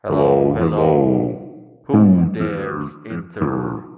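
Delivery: very low, slow pitch-shifted giant. Shown as a demonstration. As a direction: boom it out like a giant. Giant effect again.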